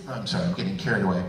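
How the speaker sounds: usual speaking voice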